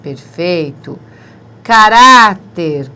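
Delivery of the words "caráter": "Caráter" slow and loud, stress on "rá"; emphasized word. Shown as a demonstration. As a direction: spell out "Caráter" slowly. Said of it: "paroxítona terminada em R". ("Caráter" lento e forte)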